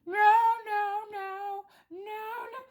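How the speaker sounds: high sung howl of no; imitating a husky wailing no; dog howling no. Imitating the dog's crying no.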